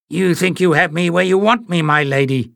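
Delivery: high, scheming villain mutter. A narrator's reading. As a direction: mutter a sly threat. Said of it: "he muttered aloud".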